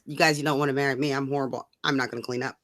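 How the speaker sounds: ordinary delivery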